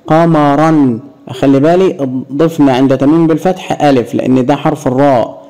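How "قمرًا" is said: قمرا as qamaran; fatha tanween. Shown as a demonstration. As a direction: end قمرا in "ran". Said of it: "عند تنوينها بالفتح".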